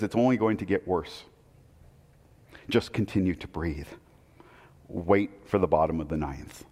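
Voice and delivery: calm reassurance. Retold or imitated. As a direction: settle him down gently, almost hushed. Ross calmly steadies Rizzo.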